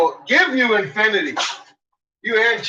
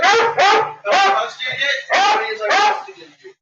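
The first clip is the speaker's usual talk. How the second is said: higher sing-song teasing; teasing baby talk; 11 semitones higher than usual. Pretend. Teasing the dog about its share, sing-song baby talk.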